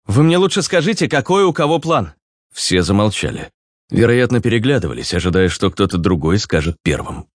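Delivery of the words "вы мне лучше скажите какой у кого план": high-pitched impatient character voice; Руслан. Acted. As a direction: press the group for a plan, pitched up. (высокий, нетерпеливый голос персонажа)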